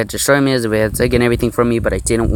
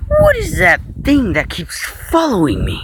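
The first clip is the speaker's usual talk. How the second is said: much higher, slow woman's voice; harsh tone. Acted. Frightened Anna confronts him, harsh and high-pitched. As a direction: demand it sharply in a high woman's voice. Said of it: "asked me in rather harsh tone".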